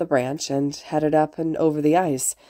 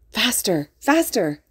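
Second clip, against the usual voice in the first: higher-pitched gleeful urging; joyful cry; 5 semitones higher, slower than usual. A narrator's reading. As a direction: urge them on, climbing higher. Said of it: "Izuku cried out in joy".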